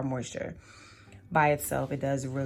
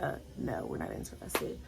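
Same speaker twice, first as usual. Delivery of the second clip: dismissive boss voice; 10 semitones lower than usual. Retold or imitated. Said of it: "And they're like".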